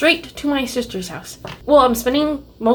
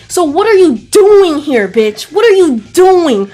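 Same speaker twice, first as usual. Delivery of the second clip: indignant; 5 semitones higher, 8 dB louder, slower than usual. Real, not acted. Angry rant at actress.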